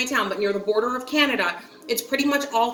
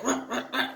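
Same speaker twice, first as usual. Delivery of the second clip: [name] growl; 6 dB quieter than usual. Shown as a demonstration. Showing viewers how her date sounds, mock [name] growl. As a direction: imitate [name]'s wordless grunts, softly. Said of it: "like, D M X sounds".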